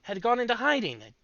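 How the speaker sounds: high gnome voice; chirpy little gnome. Acted. Gnome Teb chats with Fillmore.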